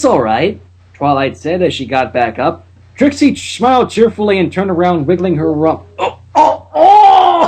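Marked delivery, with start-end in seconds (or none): 6.0-7.5 high rising squeals of delight; excited squeal. Acted. Trixie squealing at Twilight, high and excited. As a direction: squeal with glee, climbing higher.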